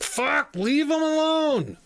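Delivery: high, exasperated yell; retold bark. Employee to his meddling manager, exasperated retold bark. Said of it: "me barking at my manager".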